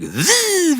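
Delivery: imitated spinning buzz. Kid spinning Beyblade toy.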